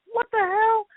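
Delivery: high-pitched baffled exclamation; retold bewilderment. Reliving hearing her name, high-pitched disbelief.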